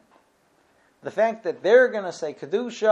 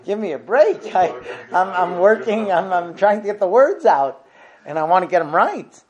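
exasperated plea; 4 semitones higher, 8 dB louder than usual. Retold or imitated. Frustrated in shul, lights shut.

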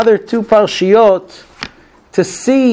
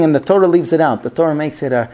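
ordinary talk, nothing marked

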